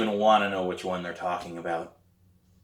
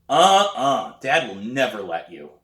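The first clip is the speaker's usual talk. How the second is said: brother refusing; 4 semitones higher, 6 dB louder than usual. A narrator's reading.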